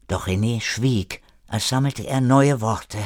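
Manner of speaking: usual speaking voice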